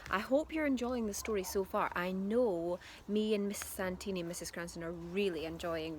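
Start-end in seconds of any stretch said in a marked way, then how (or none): none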